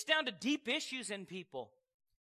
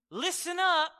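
much higher, louder call; urgent shout; 7 semitones higher, 7 dB louder than usual. Acted. Preacher as God shouting a warning to Adam, loud. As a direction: bark the warning out to wake him.

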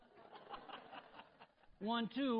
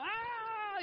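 high, excited squeal; mock celebration; 4 dB louder than usual. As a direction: imitate showy celebrating. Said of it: "they're all jumping up and down".

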